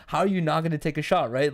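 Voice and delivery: ordinary talk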